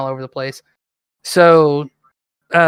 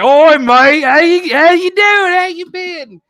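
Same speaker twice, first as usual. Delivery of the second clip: high, loud imitated Australian accent; Scar; 11 semitones higher, 6 dB louder, slower than usual. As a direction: hail a mate in exaggerated Australian. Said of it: "who's also Australian".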